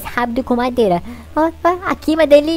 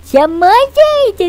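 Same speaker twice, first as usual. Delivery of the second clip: high, loud excited cry; excited shout; 9 semitones higher, 5 dB louder, much slower than usual. Real, not acted. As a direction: shout it out, thrilled by the find. Finds diamonds, exclaims. (grito animado e agudo)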